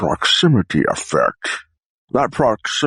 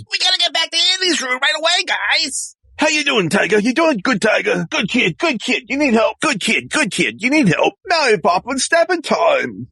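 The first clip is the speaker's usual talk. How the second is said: high, rapid-fire cartoonish voice; high chattering character. Eager helper rallies guys and Tiger, high and hurried. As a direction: chatter encouragement in a high pitch.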